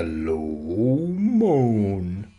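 very drawn-out moaning words; ghostly moan. Acted. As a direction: stretch LOW MOAN out like a ghost. Acts out the moan described.

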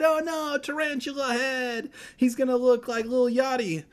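high, loud squeal; shrieking comic dismay; mock panic. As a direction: wail it in hammed-up alarm. Mock panic at tarantula on head.

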